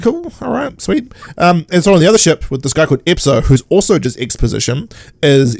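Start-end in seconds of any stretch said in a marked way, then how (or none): 0.0-1.1 mock voice. Keeps mocking the character.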